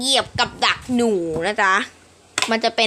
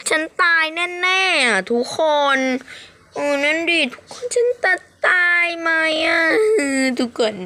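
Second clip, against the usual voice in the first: higher, whiny fake crying; mock crying; 7 semitones higher than usual. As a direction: pretend to sob about dying. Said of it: "เลิกทำเสียงแบบนี้ได้แล้วพี่[name]". (แกล้งร้องไห้ว่าจะตาย)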